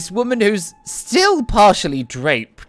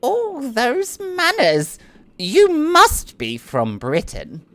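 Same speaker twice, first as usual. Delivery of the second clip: much higher, slow and flirtatious noble lady; Annette's voice; 9 semitones higher, slower than usual. Acted. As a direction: lay on the charm, slowly.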